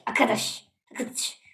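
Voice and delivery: soft, dying to a whisper; fading echo. Pretend. Echoes word down to a whisper.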